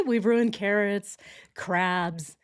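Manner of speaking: usual speaking voice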